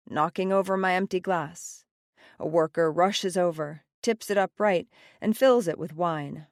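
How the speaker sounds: ordinary delivery, nothing marked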